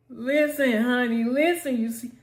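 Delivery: ordinary delivery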